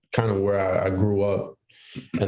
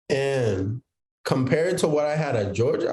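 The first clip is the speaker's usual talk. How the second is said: ordinary talk, nothing marked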